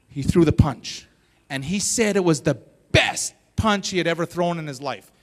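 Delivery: ordinary talk, nothing marked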